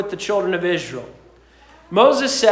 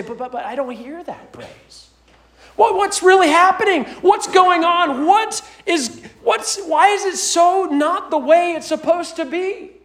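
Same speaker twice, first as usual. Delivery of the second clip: bewildered questioning; 9 semitones higher than usual. Acted. Psalmist voicing bewildered complaint.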